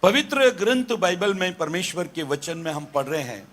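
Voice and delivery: normal talking voice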